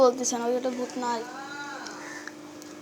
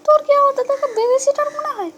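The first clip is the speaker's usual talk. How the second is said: put-on much higher, louder indignant girl voice; high girlish retort; 12 semitones higher, 4 dB louder than usual. Girl refuses to babysit for her brother, high cheeky voice. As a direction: toss back the insult like a cheeky kid.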